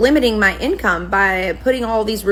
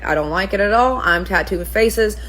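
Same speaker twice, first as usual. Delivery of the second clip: ordinary talk, nothing marked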